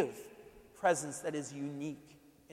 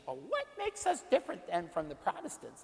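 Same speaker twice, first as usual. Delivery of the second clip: pushy lady voice; 3 semitones higher than usual. Retold or imitated.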